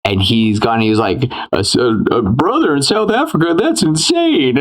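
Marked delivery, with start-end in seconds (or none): 1.5-4.6 mock shock. Voicing the shocked character.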